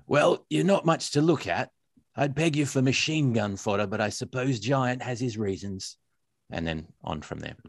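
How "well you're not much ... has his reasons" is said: higher-pitched voice for a second character; switched character voice. Acted. Ginger dwarf sizing up the narrator at the door, character voice. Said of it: "Okay, now I have to switch".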